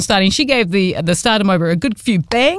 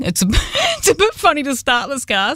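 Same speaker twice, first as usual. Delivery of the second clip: normal talking voice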